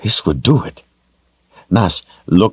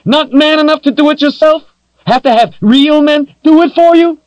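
much higher, louder woman's mockery; defiant taunt; 6 dB louder than usual. A narrator's reading. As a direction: jeer to wound his pride. Captive woman taunting her attacker.

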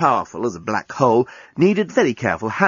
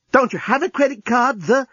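dismayed question; 12 semitones higher than usual. A narrator's reading. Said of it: "asked Lucy".

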